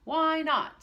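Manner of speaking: high, loud taunt; mocking challenge. Teacher taunts Pixie loudly. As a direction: fling the question back defiantly.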